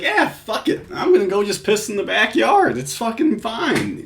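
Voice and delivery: high-pitched, mock careless shrug-off; Alonzo, dismissive. Commentator voicing suspect skipping the restroom excuse, mock-dismissive. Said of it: "Alonzo here is just like".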